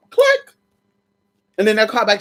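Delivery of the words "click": high, loud spoken click; mock hang-up click. Hanging up on the lying caller, sharp spoken click. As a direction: snap the hang-up noise.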